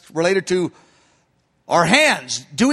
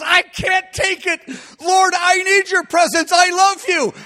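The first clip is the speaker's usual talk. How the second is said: desperate cry; 9 semitones higher than usual. Acted. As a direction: cry out as if you can't bear it. Maiden's cry when presence lifts.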